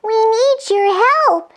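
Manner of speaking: very high fairy voice; fairy with hurting ears; tiny fairy. Acted. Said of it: "said Fairy Cora".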